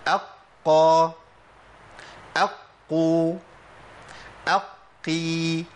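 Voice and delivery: أقا, أقو, أقي drawn out very slowly; very slow shadda drill; slow doubled qaf demo. Shown as a demonstration. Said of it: "هندخل عليه همزة".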